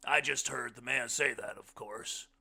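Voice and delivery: loud, high voice building in force; big-voiced guest holding forth; hearty blind man. A narrator's reading. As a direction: boom it out, rising toward the punch line. Blind man's speech in story.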